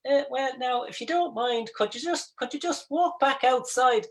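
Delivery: high, slow Irish politician voice; Irish barman sounding like Simon Harris; Irish politician impression. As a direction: mimic a fussy Irish politician, slow and pleading. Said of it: "even sounded like your man, the Irish politician".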